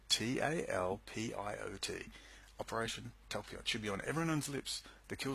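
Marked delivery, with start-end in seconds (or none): 0.1-1.9 letters "T A L P I O T" spaced out slowly; slow deliberate spelling of "T A L P I O T"; spelled out slowly. Shown as a demonstration. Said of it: "That's the key, Operation Talpiot".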